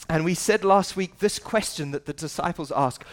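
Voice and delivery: ordinary talk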